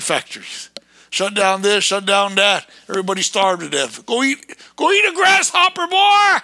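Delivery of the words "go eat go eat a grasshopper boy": rising high, loud jeer; mock them with a yell; scornful high-pitched taunt; taunting shout. Taunting the listener.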